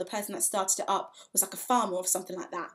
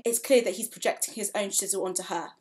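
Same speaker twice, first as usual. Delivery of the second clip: ordinary delivery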